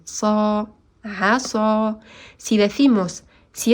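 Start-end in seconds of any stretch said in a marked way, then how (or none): 0.0-2.0 "asa" with an emphatic, heavy S; heavy sad. Shown as a demonstration. Teacher showing the heavy S sound to students. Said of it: "con la S grave".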